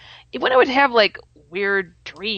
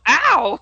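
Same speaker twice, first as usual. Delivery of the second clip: retold ouch; 7 semitones higher, 5 dB louder than usual. Retold fall onto floor.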